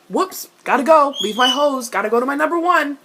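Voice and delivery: loud, high, hurried exit line; mocking Jace. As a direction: blurt it out in a mock rush to leave.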